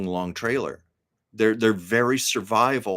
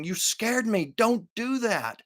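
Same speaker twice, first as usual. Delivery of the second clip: worried friend; 12 semitones higher, slower than usual. Retold or imitated. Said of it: "other friends saying".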